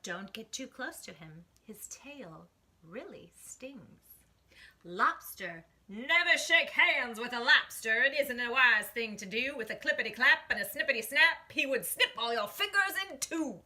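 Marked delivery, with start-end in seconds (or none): 1.7-4.0 whisper. A narrator's reading. 5.9-13.6 loud, punchy lobster warning; big loud cautionary rhyme. A narrator's reading.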